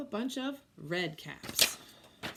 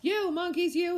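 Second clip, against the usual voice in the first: high, louder scolding; scolding call; 9 semitones higher, 7 dB louder than usual. Acted. Peddler scolding monkeys up the tree, high and louder. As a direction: shake a finger and scold. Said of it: "he said, shaking a finger at them".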